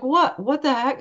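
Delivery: high, rising what in shock; stunned disbelief. Retold or imitated. Mom seeing the wallet, high and stunned. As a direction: blurt it out amazed. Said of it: "I was like".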